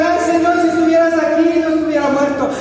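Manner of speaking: high, loud lamenting appeal; preacher acting out an anguished call; Marta calling out. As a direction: cry out to the Lord in reproach. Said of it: "cuando la hermana Marta iba a llamar".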